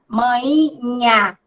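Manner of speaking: manhinha said very slowly, without the NH sound; slow demo of manhinha, nasal vowel instead of NH; drawn-out manhinha, Bahian way without NH; Bahian pronunciation. Shown as a demonstration. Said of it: "não pronuncia o N H".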